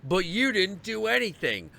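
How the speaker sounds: ordinary talk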